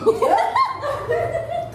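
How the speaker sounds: high burst of real laughter. Real, not acted. Preacher laughing at the first introvert who waved, high burst.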